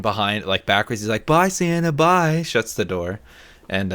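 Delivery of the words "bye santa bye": high, sing-out farewell, imitated; calling goodbye. Friend backing in, pretending to see Santa off, mock farewell call. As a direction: call it out high as if to someone leaving.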